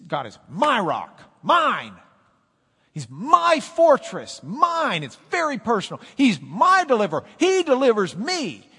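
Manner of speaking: loud, high proclamation of the psalm; full-force preaching. Acted. Pastor declares David's psalm to the church, preaching loud. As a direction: proclaim every word to the back row.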